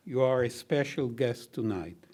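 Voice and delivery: usual speaking voice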